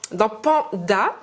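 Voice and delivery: drawn-out teaching voice on Panda; slow, drawn-out Panda. Shown as a demonstration. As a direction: say dans Panda slowly, syllable by syllable. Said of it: "tu entends le son A où".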